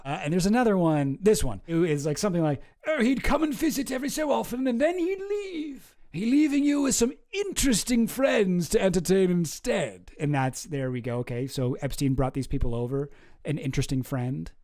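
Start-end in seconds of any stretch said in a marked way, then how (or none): 2.9-10.1 put-on high, theatrical upper-class English accent; mock posh British voice. Streamer reading the birthday book entry in a high posh British accent. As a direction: read it like a fussy old wizard. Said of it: "I sound like Merlin from Sword in the Stone".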